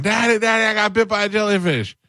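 put-on high, whiny child calling for daddy; little kid crying out. Narrator mimicking a young boy running to his father. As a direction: play a kid running up upset.

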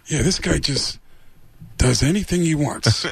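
put-on tough-guy sneer with halting pauses; Al Pacino impression. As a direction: needle him sarcastically in a put-on actor's voice. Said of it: "Al Pacino's looking at him going".

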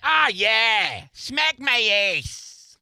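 mock-sexy Boston accent. Host proving the accent is not sexy, hammed-up Boston accent. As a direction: parody dirty talk in a broad Boston accent. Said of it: "Oh, it's not sexy?".

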